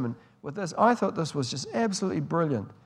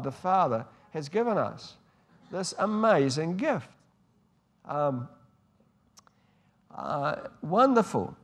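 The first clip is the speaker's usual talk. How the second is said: ordinary talk, nothing marked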